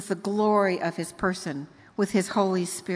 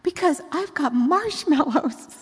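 put-on higher, louder excited child voice; little girl voice; 7 semitones higher, 4 dB louder than usual. Granddaughter on phone bragging, excited childish voice. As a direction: announce the treat like a proud toddler. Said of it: "And she said".

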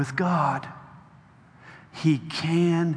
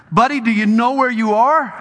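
grandpa voice; 7 semitones higher, 6 dB louder than usual. Acted. Grandpa asks lost grandson.

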